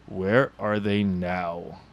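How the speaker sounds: ordinary delivery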